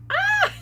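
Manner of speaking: high, loud mock sneeze cry. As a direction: yelp like a sudden sneeze. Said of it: "And it's like".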